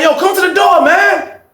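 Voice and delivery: loud, high call of "Yo". Retold or imitated. Inmate calling his friend at the dark cell door, shouting.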